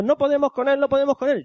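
más agudo, como compañeros que no pueden con el malo; compañeros desesperados. Retold or imitated. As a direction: imita a los compañeros agobiados en la pelea. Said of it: "Están peleando". (voz aguda de compañeros desesperados)

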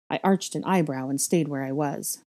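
plain reading voice

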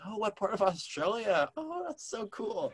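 high-pitched mock amazement; mock-awed, high and drawn out at the end; retold impressed reaction. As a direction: imitate someone gushing, voice up high. Retells dinner companions' impressed reaction.

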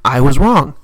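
loud, low punchline; loud declaration. Real, not acted. Vlogger admitting the girl never stopped, loud flat punchline. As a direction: drop it hard like a verdict.

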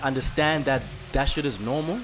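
ordinary talk

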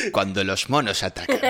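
low dramatic documentary narrator; nature documentary voice. Retold or imitated. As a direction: voice it like a wildlife film trailer. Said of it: "Ah, ya sé, la". (voz de documental de naturaleza)

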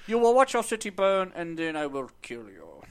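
hammed-up supervillain threat; Bane impression. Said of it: "That's my Bane".

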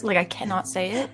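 normal talking voice

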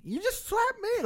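very high falsetto outcry; squealed mock protest. Drunk guy accuses the friend who slapped him, high squealed imitation.

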